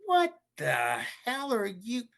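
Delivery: very high, shrieky mom imitation; shocked mom. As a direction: exclaim like a mother in disbelief. Friend's shocked mom voiced in story.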